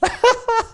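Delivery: high-pitched burst of laughter; laughing. Real, not acted. Storyteller after his accent punchline, high laughing.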